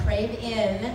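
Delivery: ordinary talk, nothing marked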